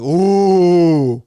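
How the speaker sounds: loud mock crowd ooh; be the whole crowd going ooh; a long, loud groaning ooh. Crowd reacting to insult.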